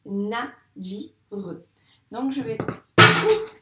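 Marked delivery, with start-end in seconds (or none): 0.1-1.1 navire in three beats, na - vi - re; syllable by syllable. Shown as a demonstration. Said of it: "On en a trois". (navire découpé : na - vi - re)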